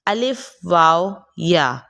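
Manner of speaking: الف، واو، یا said slowly, each vowel held open; teacher drilling the class, slow and held; practice drill. Shown as a demonstration. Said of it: "مشق".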